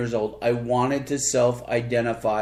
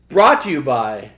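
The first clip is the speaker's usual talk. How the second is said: booming announcer plug; game show host voice; 8 dB louder than usual. Acted. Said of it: "That's me and my game show host voice".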